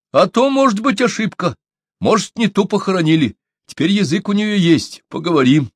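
high, lively shaman voice; shaman character. A narrator's reading. Shaman addresses the gathered crowd.

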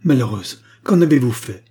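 queen's angry outburst at Diane; outraged demand. Acted.

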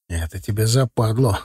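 husky low voice on «западло»; hoarse. A narrator's reading. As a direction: say it raspy and worn out. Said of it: "проговорил я хрипловато".

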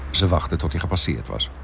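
ordinary delivery

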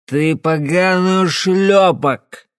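put-on slow, slurred, loud drunken insult; very drunk man cursing down the phone; blind drunk. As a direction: spit it out, drunk as a lord. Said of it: "пробормотал пьяный в стельку Эндрюс".

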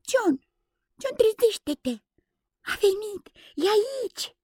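put-on very high, eager child's voice; a small boy bursting with news; excited little boy. Little Michael shaking his brother John awake, thrilled.

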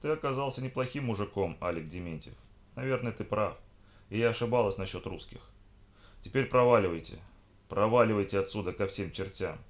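usual speaking voice

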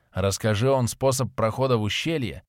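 ordinary delivery, nothing marked

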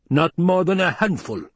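high, emphatic insistence; insistent repeat. Acted. Baron repeats himself to silent Hawat, insistent. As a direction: push the point home, pitched well up.

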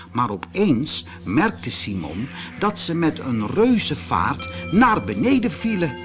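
usual speaking voice

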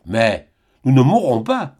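ordinary delivery

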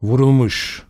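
dead, distant voice; lifeless, distant. A narrator's reading. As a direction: say it emptily, as if far away. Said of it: "Sesi anlamsız, ölü, uzaktı". (cansız, uzak bir sesle)

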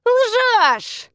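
very high, indignant snap; snapped retort. A narrator's reading. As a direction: snap back at the accuser. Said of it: "огрызнулась Цзи Юй".

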